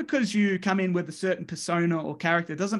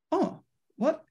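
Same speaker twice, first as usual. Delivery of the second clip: higher, startled squeak of disbelief; shocked; 9 semitones higher than usual. Retold or imitated. Friend Jeremy hearing they got the main event, stunned and high. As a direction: act floored by the news.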